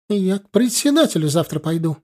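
high-pitched huffy threat; mild indignant warning; threatening. A narrator's reading. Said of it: "пригрозила нам молодая".

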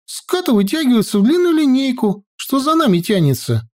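put-on high girl's voice reporting a sighting; dutiful report. A narrator's reading. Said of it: "исправно доложила Наташка".

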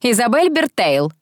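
understated fury of a matron; furious mistress. A narrator's reading. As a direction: call out the full name sternly. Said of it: "разъярённая госпожа Кэлман".

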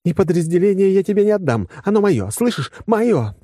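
high-pitched, emphatic woman's protest; agitated outburst. A narrator's reading. Woman refusing to give up her unit, high agitated outburst. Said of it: "вдруг вскочила на ноги".

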